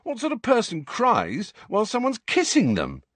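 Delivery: very high, incredulous protest; indignant. A narrator's reading. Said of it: "said Ron indignantly".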